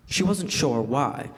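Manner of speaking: normal talking voice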